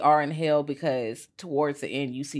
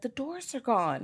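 put-on higher, slow and softer, a lost little boy; small boy; 8 semitones higher, 4 dB quieter than usual. Little boy tells the dispatcher, soft and higher. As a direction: say it like a frightened child. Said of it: "the little boy's like".

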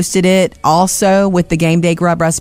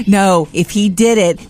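ordinary talk, nothing marked

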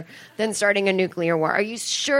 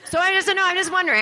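high-pitched, innocent protest; defensive questioning; 9 semitones higher, faster than usual. Acted. Child questioning parents about nukes, high defensive protest. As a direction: back off in a much higher voice.

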